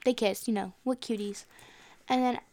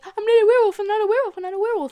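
high, loud frantic denial; hammed-up panic, repeated in a rush; freaking out; 10 semitones higher, 9 dB louder, much faster than usual. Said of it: "like, freaking out".

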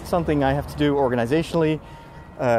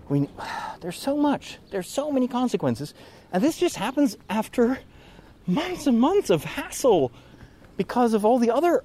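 exasperated; 9 semitones higher than usual. Real, not acted. Venting about consequences.

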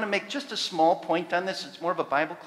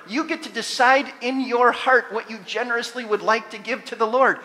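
loud, higher proclaiming voice; full-force preaching; 3 semitones higher, 8 dB louder than usual. Real, not acted. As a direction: proclaim it to the whole church.

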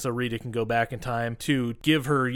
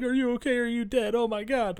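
high, anxious voices; worried Rangers; 10 semitones higher than usual. Retold or imitated. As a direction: fuss over her in alarm.

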